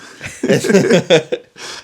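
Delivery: loud, high burst of laughter; laughing loudly. Real, not acted. Cracking up at the Brando joke, loud laugh.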